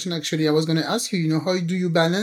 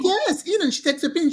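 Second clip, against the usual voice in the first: ordinary talk, nothing marked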